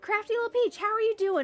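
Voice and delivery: excited greeting. Real, not acted. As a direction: sing out the name to greet them.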